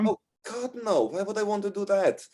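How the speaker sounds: high-pitched, protesting barmaid voice; exasperated young barmaid. Acted. Wendy rejects running mom's bar.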